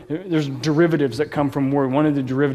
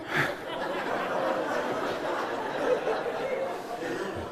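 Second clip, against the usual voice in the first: real laugh; 12 dB quieter than usual. Real, not acted. Laughing at his own story.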